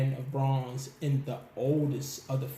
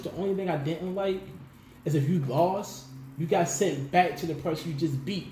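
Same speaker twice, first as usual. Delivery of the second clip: ordinary delivery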